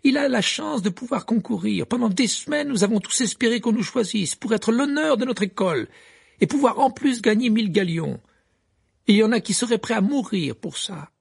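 high, loud and heated; indignant Fleur. A narrator's reading. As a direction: she can't believe his luck. Fleur continue son indignation. (Fleur indignée, voix haute)

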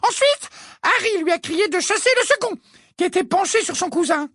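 put-on high, fast nervous old lady; firmer old woman voice. A narrator's reading. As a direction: rattle it off as a flustered neighbour. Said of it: "la voix plus forte et plus assurée".

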